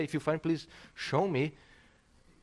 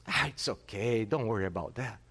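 brushing it off; 6 semitones lower than usual. Retold or imitated. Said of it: "That's not biblical".

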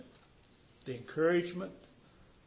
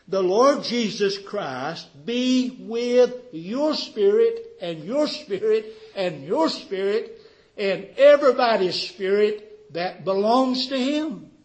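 loud, raised preacher's voice, leaning on each repeat; emphatic preached benediction; 6 semitones higher, 11 dB louder, slower than usual. Real, not acted. Preacher blessing everybody's spirit in turn, emphatic preaching. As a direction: hand the blessing forcefully to every listener.

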